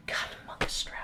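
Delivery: breathy whispered exasperation; exasperated whisper. Real, not acted. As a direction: whisper it in annoyance. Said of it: "annoyed at the name".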